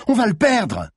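high, urgent cry; desperate shout. A narrator's reading. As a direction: cry out that time is running out. Desperate cry during car chase.

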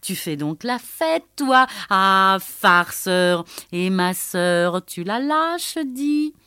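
loud, high, laughing teasing cry; mocking laughing yell; laughing shout. A narrator's reading. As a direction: yell at him through laughter. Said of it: "il lui cria dans la figure".

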